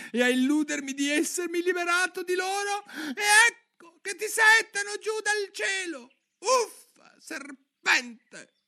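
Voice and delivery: very high, loud, crying screech; the pigeon's voice climbs to a squawk; rising to a screech. Acted. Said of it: "alzando la voce fino al garrito". (la voce del piccione sale fino al garrito)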